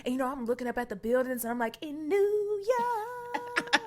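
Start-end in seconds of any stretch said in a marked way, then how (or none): 1.8-3.8 high, hugely drawn-out gawking wonder; awed sightseer. Retold or imitated. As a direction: stretch it out like a wide-eyed first-time tourist. Awestruck child gazing up at buildings.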